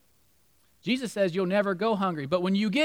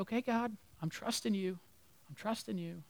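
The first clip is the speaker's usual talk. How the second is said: quiet trust; 10 dB quieter than usual. Retold or imitated. Voicing quiet surrender to God.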